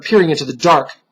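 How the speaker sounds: ordinary delivery, nothing marked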